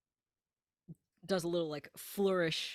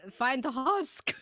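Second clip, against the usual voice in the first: voice crack; 6 semitones higher, 4 dB louder, slower than usual. Real, not acted. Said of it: "Voice crack".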